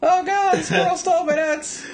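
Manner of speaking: very high, loud panicked shriek; comic shriek. Pretend. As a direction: shriek it in mock horror. Louder repeat of the wail.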